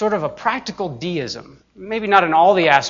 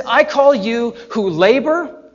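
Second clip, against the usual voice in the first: slow, louder, higher I call you who labor; emphatic quote; 7 semitones higher, 5 dB louder, slower than usual. Shown as a demonstration. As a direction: lean on each word of the call. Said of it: "He says".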